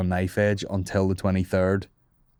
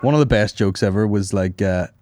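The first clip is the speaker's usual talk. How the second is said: ordinary talk, nothing marked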